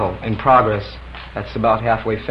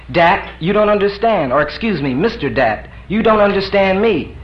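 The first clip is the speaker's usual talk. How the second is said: higher, urgent protest; insistent visitor; 8 semitones higher, 5 dB louder than usual. Acted. D protesting to Pliny, higher and urgent. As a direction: press the point. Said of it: "D insists".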